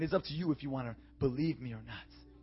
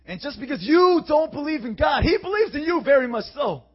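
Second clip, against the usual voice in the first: shouted, much higher and louder preaching; full-force hellfire sermon shout; 14 semitones higher, 17 dB louder than usual. Acted. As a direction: thunder it out to the congregation.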